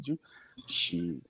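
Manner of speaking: ordinary talk, nothing marked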